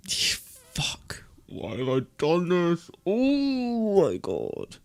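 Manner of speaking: high whisper sliding into a slow stretched groan; despairing whisper. Real, not acted. Streamer whispering in despair over his pick. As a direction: whisper in anguish, then drag it out.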